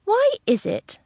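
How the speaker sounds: very high plaintive question; plaintive suitor. A narrator's reading. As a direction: lament in a lifted voice. Old gentleman laments to his beloved.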